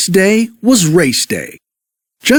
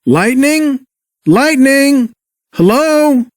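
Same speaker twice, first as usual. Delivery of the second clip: higher yelled calls into the dark forest; calling out; 9 semitones higher, slower than usual. Acted. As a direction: call out for a lost friend. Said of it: "yelled Mater".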